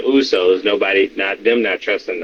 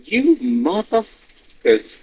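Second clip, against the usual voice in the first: mock exasperation; 11 semitones higher, much slower than usual. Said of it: "I'm like".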